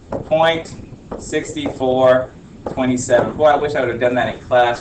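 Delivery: ordinary talk, nothing marked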